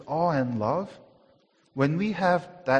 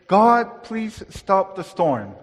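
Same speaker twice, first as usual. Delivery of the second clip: higher, louder pleading prayer, slowed; mock plea; 5 semitones higher, 8 dB louder, slower than usual. Jesus not praying to God, a mock plea. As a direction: beg God as Jesus never did. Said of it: "He doesn't say".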